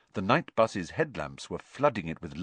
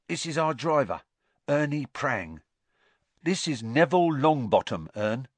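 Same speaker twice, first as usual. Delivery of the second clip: cockney lad; 5 semitones higher, 4 dB louder than usual. A narrator's reading. Stan introducing people.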